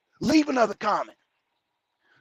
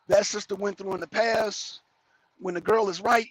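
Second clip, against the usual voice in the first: ordinary delivery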